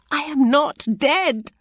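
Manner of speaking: high anguished protest; desperate cry. A narrator's reading. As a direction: cry it out, terrified. Patient protests in terror.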